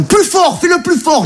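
high-pitched urging; voice on the phone. Retold or imitated. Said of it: "Et d'un coup, j'entends". (voix aiguë qui encourage)

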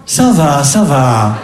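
mock mother's rasp. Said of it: "elle change sa voix ... un râle".

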